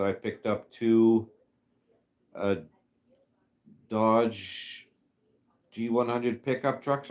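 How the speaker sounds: ordinary delivery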